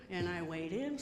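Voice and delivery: waiting trailing off into quiet. Retold or imitated. Waiting at sink.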